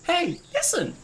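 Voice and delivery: fairy sidekick call. Pretend. Joking game reference to his friend, high piping call. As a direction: chirp it like a pestering fairy guide. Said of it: "No one gets the reference".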